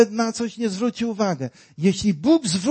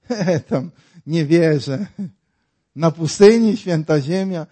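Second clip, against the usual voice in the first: normal talking voice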